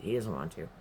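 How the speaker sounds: normal talking voice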